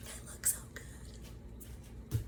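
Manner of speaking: breathless whispered gasp of delight; hushed, amazed whisper; awed whisper. Retold or imitated. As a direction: whisper it, overcome. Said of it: "I was like, gasps".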